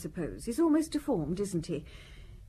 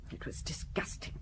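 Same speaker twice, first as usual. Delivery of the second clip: disgusted hush; 8 semitones lower, 10 dB quieter than usual. Acted. Wife recalls husband with girl.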